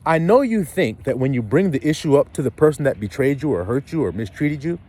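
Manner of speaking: ordinary talk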